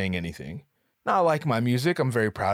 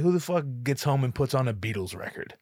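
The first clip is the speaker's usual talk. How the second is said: normal talking voice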